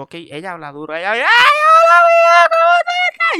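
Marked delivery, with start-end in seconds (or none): 1.1-3.4 very high, booming shrieked greeting; loud clown mom. Retold or imitated. Clown mother-in-law greeting the boyfriend, very high and loud. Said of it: "ella habla bien duro".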